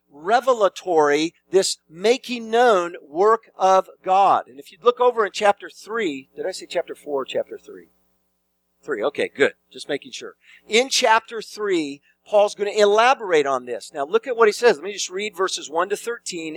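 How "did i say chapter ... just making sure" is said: lower, softer self-check; quick aside. Real, not acted. Preacher checking the chapter number with listeners, quiet aside. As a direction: drop out of preaching into a low, offhand murmur.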